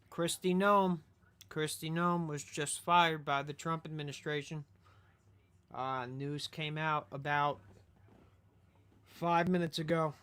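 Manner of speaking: loud, emphatic news break; breaking-news announcement. Real, not acted. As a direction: announce it loudly to the whole audience.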